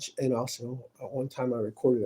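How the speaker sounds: usual speaking voice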